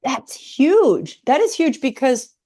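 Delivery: loud, high burst of enthusiasm; really light up at the idea; it's huge, a big, bright, emphatic delivery; excited. Real, not acted. Enthusiastic agreement with guest.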